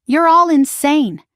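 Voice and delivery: high, loud and slow; angry. A narrator's reading. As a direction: spit it out in fury. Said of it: "Gavin spat".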